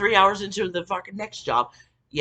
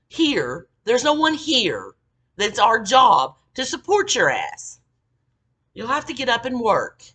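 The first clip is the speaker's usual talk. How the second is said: high, loud, emphatic scolding; retold yelling; 7 semitones higher, 7 dB louder than usual. Coworker tells the crying girl to work, retold scolding yell. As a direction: lay down the law, loud and high. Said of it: "yelled at her".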